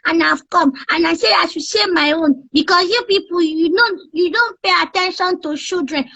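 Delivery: put-on high kid voice, complaining, ending in a shout; a fed-up child lecturing the adults; baby voice. Child character scolding adults about water on air. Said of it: "just use that baby voice".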